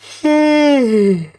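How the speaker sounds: long loud sigh, high; loud frustrated sigh. Real, not acted. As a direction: let out a big exasperated sigh. After failing shrine.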